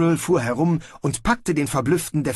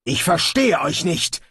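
thundering; 6 semitones higher than usual. Acted. Snibral scolding the brawling soldiers, thundering. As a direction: thunder it at them. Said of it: "donnerte Snibral".

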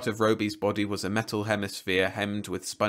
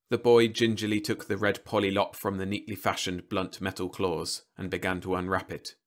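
usual speaking voice

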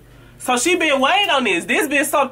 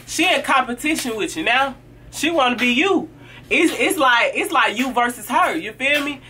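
usual speaking voice

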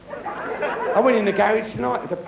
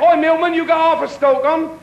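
higher, louder cheeky call; shouting out; 7 semitones higher, 6 dB louder than usual. Acted. Woman on fourth floor shouting to milkman, loud. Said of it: "shouted out".

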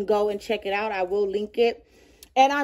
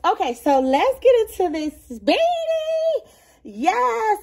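high, bright and eager; excited high intro; 11 semitones higher than usual. Real, not acted. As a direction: jump into the next item with glee. Excited start of bag section.

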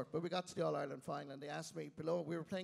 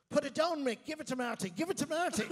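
high, loud urging calls; sideline shouts; 9 semitones higher, 6 dB louder than usual. Retold or imitated. Lads on the sideline urging Mick to pass, shouted high. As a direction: imitate team-mates yelling for a pass. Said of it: "the lads along the sideline, I could hear him clearly".

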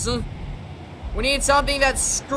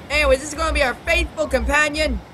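ordinary delivery

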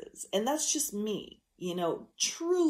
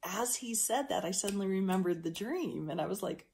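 ordinary talk, nothing marked

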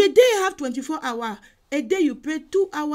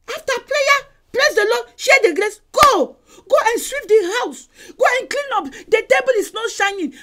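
loud, high, rapid-fire commands; mock-stern house orders, louder and higher; bossy orders; 6 semitones higher, 5 dB louder than usual. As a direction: hustle them off to their chores. Ordering people home to chores.

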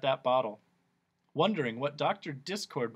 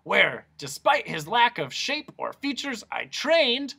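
higher, louder; raised pompous doctor; exasperated doctor; 10 semitones higher, 5 dB louder than usual. Acted. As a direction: press on over the interruptions.

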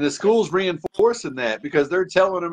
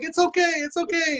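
put-on high pleading child voice, fading softer; son pleading; 12 semitones higher, 3 dB quieter than usual. A mother imitating her son before a meltdown, high. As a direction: mimic a frightened boy, high and small. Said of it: "he's like".

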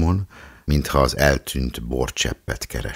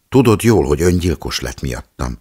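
proud declaration; 4 semitones higher, 7 dB louder than usual. A narrator's reading. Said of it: "szögezte le büszkén Éva néni".

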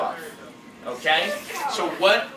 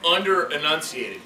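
ordinary talk

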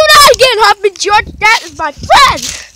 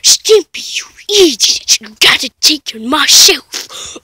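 lightly voiced cartoon scolding, falling low; Ren; 7 semitones lower than usual. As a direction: do a hint of a cross cartoon character. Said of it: "I do the ran voice".

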